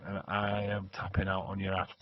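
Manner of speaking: usual speaking voice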